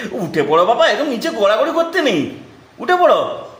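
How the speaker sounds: rising high coaxing voice. Real, not acted. Coaxing toddler to get up.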